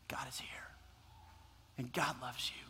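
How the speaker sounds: soft, low whisper; hushed promise to the congregation; whispered assurance. Real, not acted. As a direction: lean in and whisper it close.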